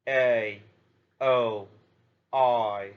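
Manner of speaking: "a", "o", "i" said alone, each glide drawn out; teacher listing impure diphthongs aloud; sounding out diphthongs. Shown as a demonstration. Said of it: "Three diphthong impure English sounds are".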